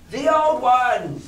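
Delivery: high, slow call from the crew; sailor crying out. Acted. A crewman asking Long John for the old song, high and slow. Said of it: "cried another".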